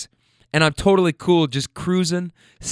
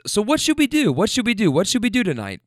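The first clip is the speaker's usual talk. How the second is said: higher, eager party voice; excited drunk friend; 6 semitones higher than usual. Retold or imitated. Tipsy friend in the back seat, eager. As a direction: pester the driver for plans.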